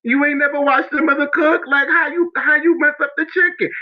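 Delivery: high mock-angry mother scolding; mad mom. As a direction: imitate an upset mother scolding her kid, lightly. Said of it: "And she was so mad".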